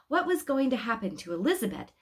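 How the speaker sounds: ordinary delivery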